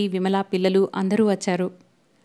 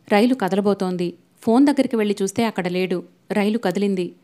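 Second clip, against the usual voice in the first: usual speaking voice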